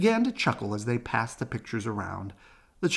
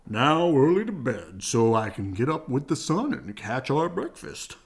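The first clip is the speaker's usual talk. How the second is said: Papa Bear voice; 2 semitones higher, 4 dB louder than usual. Acted. Papa sleepy at bedtime.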